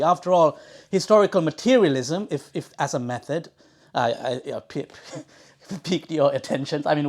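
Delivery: normal talking voice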